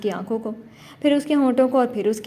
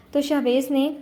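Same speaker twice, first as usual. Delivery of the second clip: ordinary delivery, nothing marked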